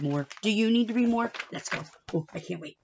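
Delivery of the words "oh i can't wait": plain reading voice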